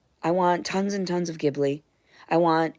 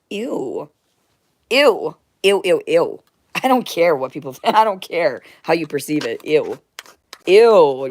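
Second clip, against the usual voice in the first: disgusted ew; 8 dB louder than usual. Real, not acted. Disgusted at working to death.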